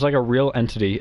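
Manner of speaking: normal talking voice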